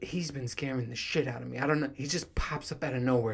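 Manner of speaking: ordinary talk, nothing marked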